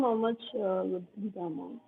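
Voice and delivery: fading to almost nothing; trailing off very quietly; barely audible. Real, not acted. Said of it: "Sorry, your voice is very low".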